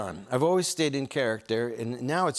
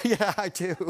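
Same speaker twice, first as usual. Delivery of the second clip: laughing through the words, pitched up; odd put-on laugh; 6 semitones higher than usual. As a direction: slip in the weird character laugh. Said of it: "And I developed this laugh".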